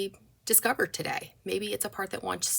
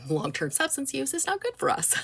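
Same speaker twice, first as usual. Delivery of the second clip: said through a light laugh, higher; amused; 9 semitones higher than usual. Real, not acted. Counselor to viewers about harmful coping, amused. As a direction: let a chuckle lift the words.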